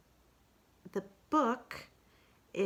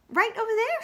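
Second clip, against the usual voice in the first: much higher, louder; Pooh voice; 8 dB louder than usual. Acted. Pooh points behind Kanga, higher. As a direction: point eagerly.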